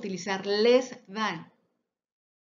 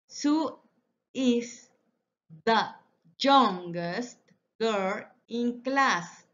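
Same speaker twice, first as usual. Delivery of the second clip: each word set apart, slow and clear; slower than usual. Shown as a demonstration. Teacher to class, modelling the sentence slowly word by word. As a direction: spell it out one word at a time for the class.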